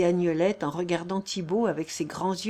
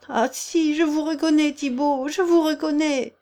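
higher, louder, breathless recognition; frightened cry; 7 semitones higher, 4 dB louder than usual. Acted. Agnelette cries out in fear, recognising Thibault. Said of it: "s'écria la jeune femme".

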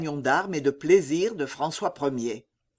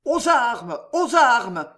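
louder, higher call to arms; battle cry; 6 semitones higher, 5 dB louder, slower than usual. A narrator's reading. French soldiers shouting the alarm, battle cry. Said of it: "criaient les Français".

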